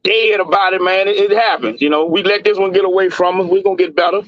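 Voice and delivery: ordinary delivery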